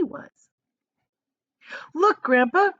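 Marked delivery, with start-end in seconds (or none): 1.9-2.7 high, loud excited call; Charlie cries out. A narrator's reading. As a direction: point it out eagerly. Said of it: "cried Charlie".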